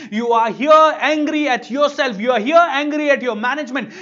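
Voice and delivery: loud, heated lecture to the class; angry scolding. Retold or imitated. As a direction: scold them hard, building to a peak. Trainer scolding the group.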